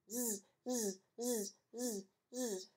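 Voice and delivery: soft drawn-out zzz snores; snoring. Acted. Amy snoring in bed, soft zzz snores. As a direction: snore softly, child fast asleep. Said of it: "Amy snored".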